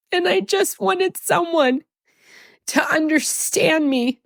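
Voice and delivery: pushing the words out through real tears; tearful, high and strained, fighting to keep talking; crying. Real, not acted. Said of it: "stop crying so you can teach us".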